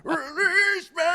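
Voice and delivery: high, strained scream of the lyric; imitating a rock singer's scream. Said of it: "And then he just screams".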